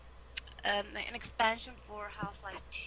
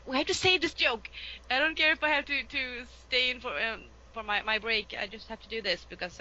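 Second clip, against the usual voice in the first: mock-stubborn, pitched up then easing off; acted insistence; 5 semitones higher than usual. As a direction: plead your case in a raised voice. Acts out herself insisting on a joke.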